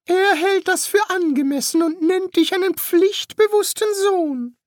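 very high-pitched put-on priest voice. Priest relaying the ghost's greeting to the son, high put-on voice. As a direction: pitch the priest far above the narrator.

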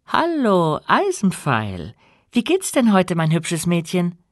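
bright, high and cheerful greeting; cheerful girl. A narrator's reading. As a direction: coo to the engine like a pet. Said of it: "tätschelte Emilia die Lok und sagte".

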